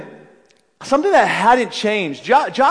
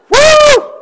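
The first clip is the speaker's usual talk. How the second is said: high loud woo; mock cheer; 11 dB louder than usual. Saul imagined cheering for David, loud whoop. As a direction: whoop like a delighted fan.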